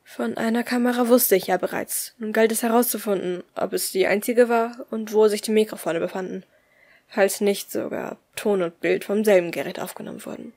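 plain reading voice

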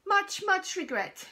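a shopkeeper calling from across the shop, loud and high; called out. A narrator's reading. Shopkeeper calls to the customers across the shop, loud and high. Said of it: "he called out".

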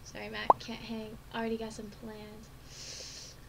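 usual speaking voice

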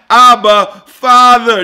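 loud high cry to a father; fervent cry of Abba. Acted. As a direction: call out like a child to its dad. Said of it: "by whom we cry".

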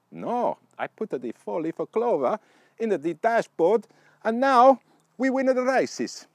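loud, high, hammed-up Italian racer; exaggerated Italian accent, high and loud, drawn out at the end; mock Italian accent. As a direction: answer triumphantly like a stage Italian. Voicing Ugo's reply to his friends.

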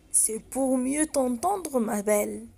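put-on wolf soothing the girl, slow and sly. Wolf answers in disguise.

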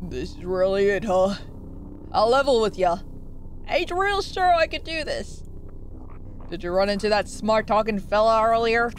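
put-on low, folksy old-timer voice; old man voice. Streamer voicing a big old fish in game dialogue, old man voice. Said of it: "That's an old man voice".

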